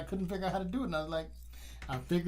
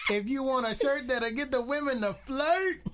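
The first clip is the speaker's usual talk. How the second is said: shouting ad; 10 semitones higher than usual. Real, not acted. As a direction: shout it like a pitchman. Ad jingle shouted.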